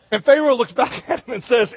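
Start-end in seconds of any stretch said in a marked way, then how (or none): none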